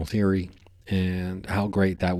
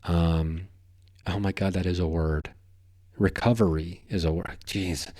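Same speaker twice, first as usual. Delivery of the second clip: ordinary talk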